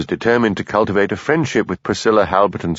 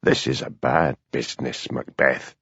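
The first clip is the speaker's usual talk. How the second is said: low, soft, cold and furious; quiet fury; 8 semitones lower, 6 dB quieter than usual. A narrator's reading. Superintendent scolding Hamish, low quiet fury. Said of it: "in the quiet voice he used when he was really furious".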